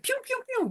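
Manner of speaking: very high, soft "pew" sounds; cartoonish little firing noises; imitated squirting noise. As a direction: squeak out tiny playful zaps. Imitates body firing love drugs.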